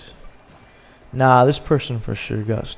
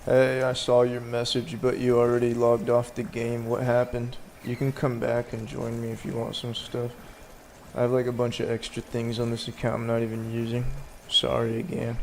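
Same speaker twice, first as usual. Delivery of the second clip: much softer, kind and apologetic; soft voice message; 7 dB quieter than usual. Real, not acted. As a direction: leave her a soft, gentle message. Recording a message to homegirl.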